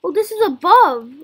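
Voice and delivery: usual speaking voice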